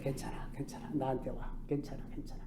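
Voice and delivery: soft unvoiced coaxing, like soothing a child; coaxing whisper. Lord soothing his people, whispered coaxing. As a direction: whisper it gently to lure them close. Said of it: "살살살살".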